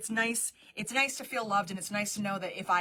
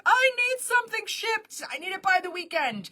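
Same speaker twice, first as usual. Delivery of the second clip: pleading customer; 9 semitones higher, 4 dB louder than usual. Retold or imitated.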